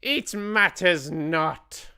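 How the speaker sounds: high, slow, dismissive witch voice; a witch brushing off the lost mouse; witch's shout. A narrator's reading. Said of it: "shouted the Grand High Witch".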